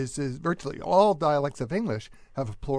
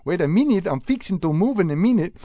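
imitating a Spanish accent in English, louder; light Spanish-accented English; mild mock Spanish accent; 5 semitones higher, 4 dB louder than usual. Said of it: "which is sort of like Spanish accent in English".

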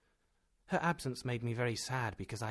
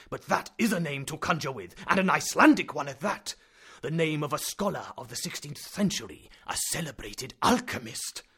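excited; 5 semitones higher, 10 dB louder than usual. Acted. Professor exults over discovery.

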